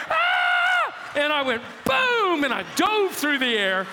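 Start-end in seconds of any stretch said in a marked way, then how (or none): 0.1-0.9 very high, loud wrestling cry; a comic wrestler's war cry; battle yell. Retold or imitated. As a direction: leap off the ropes yelling.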